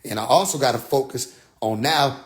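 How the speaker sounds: ordinary talk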